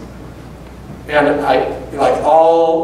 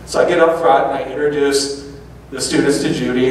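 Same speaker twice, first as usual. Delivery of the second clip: normal talking voice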